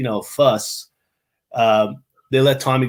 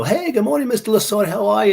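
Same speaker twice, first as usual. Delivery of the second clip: high-pitched, fawning hello to a celebrity; gushing greeting; 11 semitones higher, 4 dB louder than usual. Retold or imitated. Airport staff greeting a famous baseball man, gushing. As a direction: imitate starstruck staff greeting a big name, pitched way up.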